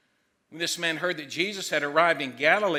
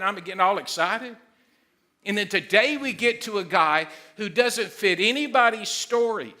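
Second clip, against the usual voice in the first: ordinary talk, nothing marked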